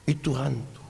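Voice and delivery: dropped to a soft, low aside; hushed repeat. Retold or imitated. Preacher softly echoing the disciples: itu hantu, hushed. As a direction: say it quietly, almost to yourself.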